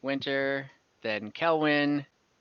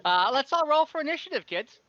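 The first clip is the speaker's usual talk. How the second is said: teasing DM voice; 10 semitones higher, 4 dB louder than usual. Acted. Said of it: "DM calling the table to roll".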